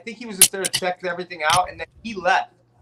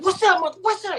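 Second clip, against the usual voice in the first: high-pitched, jumpy imitation of an aggressor; mock confrontational challenge; 13 semitones higher, 4 dB louder than usual.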